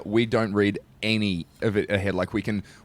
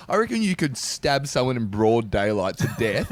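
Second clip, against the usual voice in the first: ordinary talk, nothing marked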